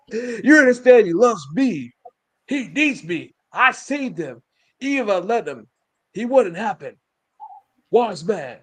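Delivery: high, emphatic mock indignation; imitated possessive chief. Chief claiming his wise man's loyalty, high acted indignation. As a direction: insist on it phrase by phrase.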